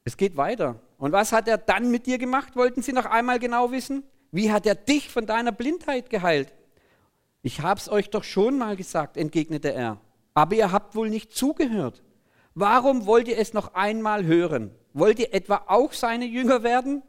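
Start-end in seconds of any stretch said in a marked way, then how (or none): 1.0-6.5 high, pressing interrogation; demanding Bible-story questioners, voiced high; insistent questioners. A narrator's reading. Acts out the questioners in reading. 7.4-17.0 high, loud, challenging retort; fed-up Bible figure answering back; exasperated healed man. A narrator's reading. Acts out the healed man in reading.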